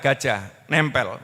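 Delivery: ordinary talk, nothing marked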